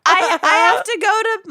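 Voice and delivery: high, bright mock friend voice; imitating Lucy. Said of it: "Lucy's like".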